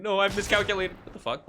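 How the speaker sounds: ordinary talk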